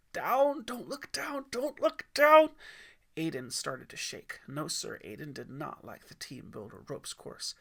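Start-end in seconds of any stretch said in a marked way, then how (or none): 0.1-2.5 high, rising panic, louder each time; frantic whisper building to a shout; panicked self-warning. Acted. Boy on the platform warning himself in panic.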